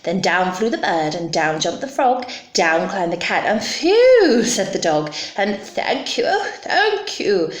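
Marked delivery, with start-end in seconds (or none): 3.6-4.4 high, loud exhale of relief; relieved phew. Acted. Dog after the dragon leaves, high relieved phew. 5.6-7.5 high, gushing thanks; grateful witch. Acted. Witch thanks her rescuers, high grateful voice. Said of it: "said the grateful witch".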